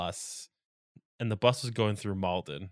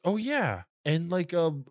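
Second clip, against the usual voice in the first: lightly put-on higher voice; mock tipsy guy; 6 semitones higher than usual. Tipsy man to woman on bus, mock oblivious voice. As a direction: imitate an oblivious guy, pitched up.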